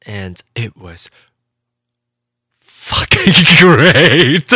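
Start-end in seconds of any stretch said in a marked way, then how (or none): 2.6-4.6 slow, loud, laughing emphasis; real giddy joy recalling business class; laughing delight. Real, not acted. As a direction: stretch it out and laugh through it. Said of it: "the only way I can express it".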